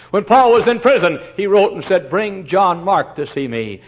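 high, loud preacher's cry, slowing at the end; fiery preaching. Acted. Preacher to congregation, loud and rising. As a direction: preach it out with force.

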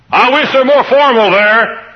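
high, loud mock of a fussy visitor; mocking prim churchgoer. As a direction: lampoon the formal folks. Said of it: "and say".

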